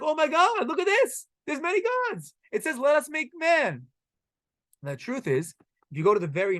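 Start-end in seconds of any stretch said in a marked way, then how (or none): 0.0-3.9 high-pitched, mock-thrilled outburst; excited. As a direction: act out gleeful polytheists pouncing on a discovery. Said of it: "They get so excited 'cause they're like".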